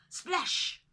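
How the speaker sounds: high, loud called-out splash. A narrator's reading. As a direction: call out the splash. Sound effect called out.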